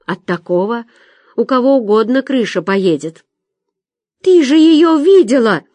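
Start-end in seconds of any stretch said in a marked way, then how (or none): 4.2-5.6 high, loud outburst; sudden sharp cry. A narrator's reading. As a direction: burst out sharply at the listener. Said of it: "вдруг резко выкрикнула Соня". (резкий внезапный выкрик)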